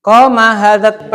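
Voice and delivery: slow, emphatic "Koma hadat" for the class; slow emphatic reading. Shown as a demonstration. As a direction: lean on "Koma hadat" slowly so they notice. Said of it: "Perhatikan, Pak".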